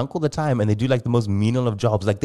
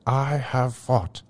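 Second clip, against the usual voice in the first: slow, raised statesman impersonation; slow Mandela-style oratory; Nelson Mandela; 4 semitones higher, slower than usual. Acted. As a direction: speak slowly and solemnly, drawing out each phrase. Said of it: "Give us your Nelson Mandela".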